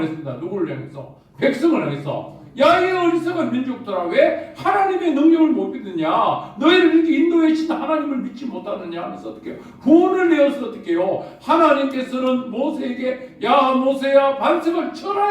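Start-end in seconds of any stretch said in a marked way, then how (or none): 2.6-9.0 higher, louder scolding cry at the foolish people; Moses rebuking. Acted. 13.4-15.3 a hint of a higher, louder divine command; God commanding.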